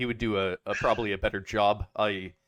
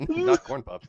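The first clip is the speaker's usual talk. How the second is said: Not Corn Pop, squeaked very high in laughing disbelief; amused high-pitched protest; mock dismay; 5 dB louder, slower than usual. Real, not acted. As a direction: yelp it in amused shock. Laughing at impression punchline.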